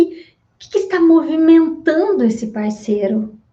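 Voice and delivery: normal talking voice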